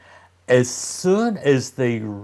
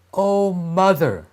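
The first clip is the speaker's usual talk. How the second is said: put-on higher, earnest little boy; innocent boy; 8 semitones higher, 3 dB louder than usual. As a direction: plead sweetly to mother. Hansel answers stepmother.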